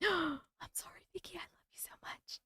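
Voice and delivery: a gasp, then a whisper; whispered apology. Real, not acted. Streamer whispers an apology to the viewer Vicky.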